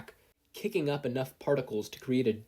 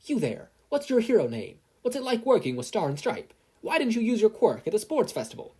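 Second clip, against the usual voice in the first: much higher, louder barrage; pushy reporter; 11 semitones higher, 5 dB louder than usual. Acted. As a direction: fire questions at him. Pushy reporter in his face.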